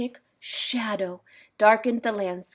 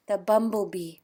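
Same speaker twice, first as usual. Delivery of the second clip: ordinary delivery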